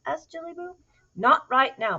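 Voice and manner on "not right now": louder, higher mother's no; firm refusal. A narrator's reading. Mama cat answering her little girl, firm. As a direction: put a gentle foot down.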